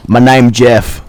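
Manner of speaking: deadpan the meme. Pretend. Masked kid saying the meme to a householder, deadpan. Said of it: "the meme lord inside of me awoken".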